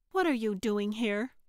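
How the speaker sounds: lightly snapped, annoyed question; snapping. A narrator's reading. Dancer confronts the woman in the alley, mildly snapping. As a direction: snap it with restrained irritation. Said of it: "Trixie snapped".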